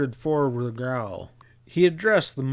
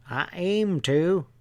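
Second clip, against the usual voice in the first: slow, higher, unhurried country drawl; placid drawl; 6 semitones higher, slower than usual. Acted. Stableman unbothered by glaring Ezra, placid drawl. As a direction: drawl it out like nothing could bother you. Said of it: "the man said in a placid drawl".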